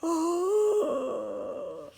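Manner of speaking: long, high gasp falling away; drawn-out storybook gasp of alarm. Acted. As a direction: gasp slowly in mock shock. Reader reacts as peddler wakes.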